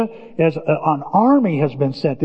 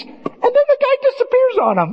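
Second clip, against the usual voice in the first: very high, incredulous squeal; mock amazement. Preacher telling of Jesus vanishing, mock amazed. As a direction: tell it in squeaky disbelief.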